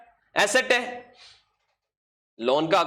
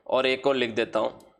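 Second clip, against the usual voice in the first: slow, drawn-out words spoken as they are written on the board; slow dictation while writing; 5 semitones lower, much slower than usual. Shown as a demonstration. Teacher writing entries on the board, slow dictation. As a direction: dictate each word slowly as you write it.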